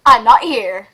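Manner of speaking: a hint of a loud panicked Russell; mock Russell. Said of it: "That's what he's thinking".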